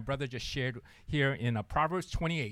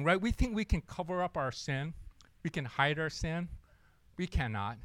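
ordinary talk, nothing marked

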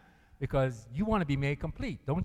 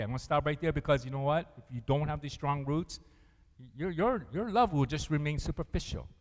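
ordinary talk, nothing marked

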